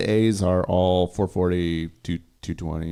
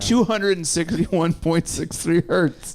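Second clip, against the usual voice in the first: high, slow put-on voice; drawn-out, high-pitched mock lesson; mock recitation; 8 semitones higher, slower than usual. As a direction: spell out the figure in mocking precision. Mocks teaching kids exact frequency figures.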